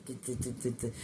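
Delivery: stuck repeating one small word; stuttering. Real, not acted. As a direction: stumble over the word in a quick stutter. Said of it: "I'm stuttering".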